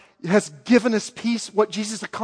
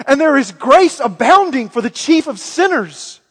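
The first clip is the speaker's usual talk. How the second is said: louder, ringing sermon voice as Paul; Paul preaching; 4 semitones higher, 5 dB louder than usual. Retold or imitated. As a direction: proclaim it boldly to young Timothy. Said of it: "he says".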